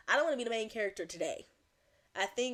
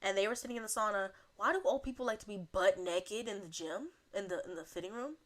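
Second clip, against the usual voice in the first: ordinary talk, nothing marked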